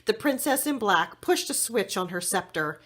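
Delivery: plain reading voice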